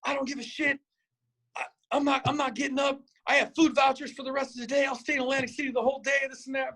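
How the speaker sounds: high-pitched mock tantrum; freaking out. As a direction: imitate a friend in a frantic refusal. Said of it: "and he's freaking out".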